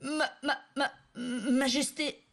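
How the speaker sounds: high, slow stuttered title; stammering. Acted. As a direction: stammer it out, frozen in shock. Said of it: "bredouilla Giorgio".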